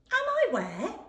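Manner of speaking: a small lost creature wondering aloud; young hedgehog voice. Acted. Max alone in the park, puzzled small voice.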